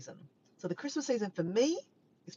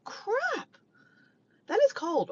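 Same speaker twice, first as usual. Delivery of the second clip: surprised; 4 dB louder, slower than usual. Real, not acted. Reading twenty-one degrees.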